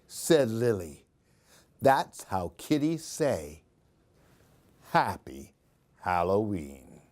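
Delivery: lively, sing-song storybook reading; animated picture-book read-aloud; reading to children. A narrator's reading. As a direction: read it like story time for little ones.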